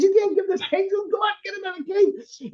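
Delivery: high, fast, mock-furious mother; angry Italian mother. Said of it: "the angry Italian mother".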